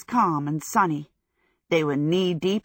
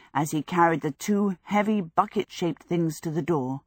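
usual speaking voice

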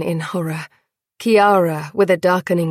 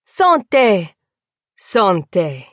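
cry of toast; 4 dB louder, slower than usual. Acted. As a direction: raise a glass and shout. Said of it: "came the cry".

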